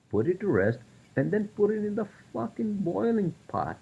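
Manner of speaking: exasperated high-pitched tirade; angry rant. Real, not acted. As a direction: rail at the hypocrisy, voice climbing high. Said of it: "that was my rant".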